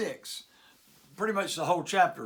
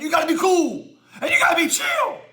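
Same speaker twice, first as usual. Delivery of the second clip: higher and louder, rising to a yell; worked-up shout; 10 semitones higher, 10 dB louder than usual. Acted. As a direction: yell about being chill. Host getting worked up.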